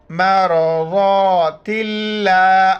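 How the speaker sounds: اللہ read with light, thin lam; thin Allah. Shown as a demonstration. Teacher shows the thin Allah after a kasra. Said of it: "لفظ اللہ باریک کر کے پڑھیں گے".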